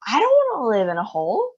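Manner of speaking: lightly shouted complaint; mock shout. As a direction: protest loudly but not full force. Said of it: "Bruno shouted".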